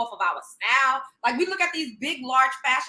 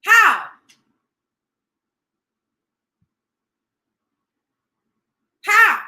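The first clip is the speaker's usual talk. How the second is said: very loud, higher demand; loud How; 7 semitones higher, 10 dB louder than usual. Real, not acted. Demanding an answer from viewers, loud How. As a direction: shout How at the chat.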